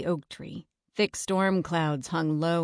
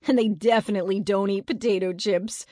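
higher, lightly mocking voice; amused mockery; 3 semitones higher, 4 dB louder, faster than usual. A narrator's reading. Eddie mocks the ghost idea to friends, amused. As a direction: scoff as if laughing. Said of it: "Eddie laughed".

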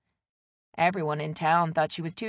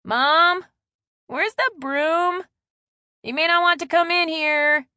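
loud, high shout to another room; teenage son yelling through the house; calling out; 11 semitones higher, 11 dB louder, slower than usual. A narrator's reading. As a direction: holler for mom with drawn-out vowels. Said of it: "as Austin called out".

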